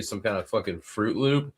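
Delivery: normal talking voice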